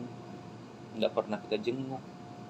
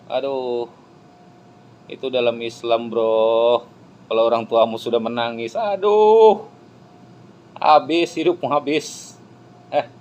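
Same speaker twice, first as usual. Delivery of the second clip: alarmed preaching; 2 semitones higher, 10 dB louder than usual. Real, not acted. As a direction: cry out the danger to the crowd. Preacher warns about crying parents. (seruan peringatan yang lantang dan cemas)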